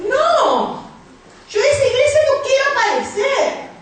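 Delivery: high, emphatic mock protest; acted refusal. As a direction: play a husband flatly refusing to go. Acted husband refusing church. (negativa rotunda actuada, en tono agudo)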